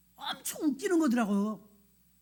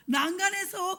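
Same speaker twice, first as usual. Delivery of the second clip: ordinary talk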